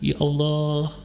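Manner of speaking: long drawn-out, subdued call to God; understated mock weeping, vowels stretched; demonstrated crying. Shown as a demonstration. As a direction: mildly imitate a tearful plea. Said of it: "nangis gitu".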